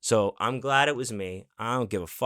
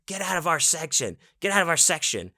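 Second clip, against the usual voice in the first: retold mock scream; 7 semitones higher than usual. Angry frat guy ordering the storyteller out, mock screaming. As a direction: imitate a man screaming, with the volume held back. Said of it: "He is screaming to get me out of there".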